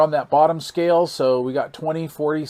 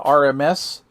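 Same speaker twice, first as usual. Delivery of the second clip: normal talking voice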